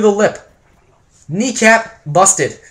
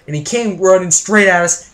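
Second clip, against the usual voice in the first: ordinary delivery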